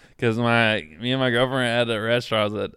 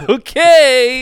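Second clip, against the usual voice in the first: mock taken aback; 12 semitones higher, 11 dB louder than usual. Taken-aback diners' reply.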